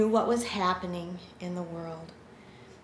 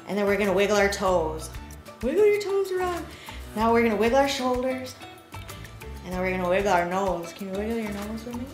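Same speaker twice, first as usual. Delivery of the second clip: bright, bouncy action-song leader; kids show host; 3 dB louder than usual. Acted. Leads a wiggle song with kids.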